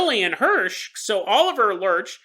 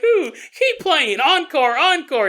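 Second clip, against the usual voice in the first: slow laughing ooh, then loud encore calls; excited cheering; 7 semitones higher, 5 dB louder, slower than usual. Pretend. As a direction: cheer him on while dancing, laughing. Dancing, cheering the banjo man.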